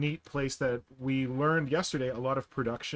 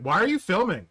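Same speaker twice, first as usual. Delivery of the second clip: loud, higher-pitched imitation of a suspicious question; imitated challenge; 7 semitones higher, 8 dB louder than usual. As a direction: mimic a shopkeeper confronting someone filming. Retold shopkeeper challenging the filming.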